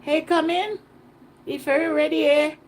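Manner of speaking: loud, high beckoning call; islander impression. Islander inviting a visitor in, imitated loud hail. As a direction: mimic a local hollering someone inside. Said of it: "that's how they talk".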